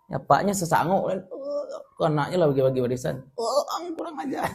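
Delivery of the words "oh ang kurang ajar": mock outrage.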